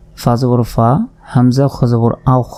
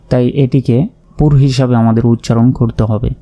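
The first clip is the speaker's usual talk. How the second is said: ordinary talk, nothing marked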